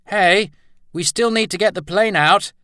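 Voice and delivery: loud, high call across the shed; man calling to the others as the doors swing shut. Acted. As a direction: shout it over to the doors. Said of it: "he called".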